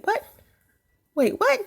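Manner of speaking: high, sudden wait what; surprised. Real, not acted. Reading a confusing comment, high surprised squeak. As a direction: caught off guard, squeak it out.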